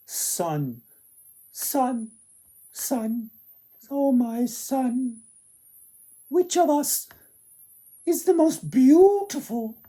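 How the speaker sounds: high, loud pleading call; sisters calling to the sun. Acted. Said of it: "and asked".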